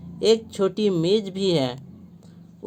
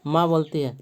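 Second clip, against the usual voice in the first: ordinary delivery, nothing marked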